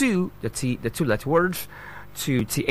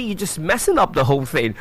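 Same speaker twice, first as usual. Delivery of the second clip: ordinary talk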